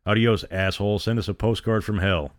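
plain reading voice

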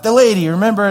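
high, loud, eager prompting; excited reminder. Retold or imitated. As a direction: jog a friend's memory excitedly. Retelling excited reminder to friend.

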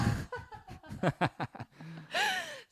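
high shrieking burst of laughter. Real, not acted. Laughing at the story, shrieking laugh. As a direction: howl with laughter.

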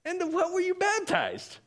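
very high, squeaky questioning; comic high-pitched disbelief; acted astonished question. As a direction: cry it out in falsetto. Acting Paul's incredulous question.

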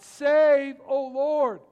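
loud, high plea for rescue; anguished shout for help; desperate cry. Acted. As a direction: cry out to God from your knees. People crying out to God.

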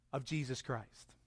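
ordinary delivery

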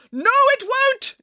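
very high, drawn-out contradiction; indignant squeal of disagreement; emphatic interruption. Acted. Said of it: "interrupted Nanny Piggins".

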